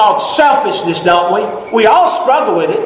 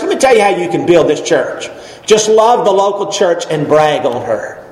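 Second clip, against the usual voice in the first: normal talking voice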